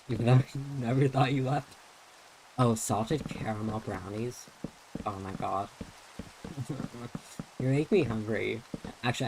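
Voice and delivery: ordinary talk, nothing marked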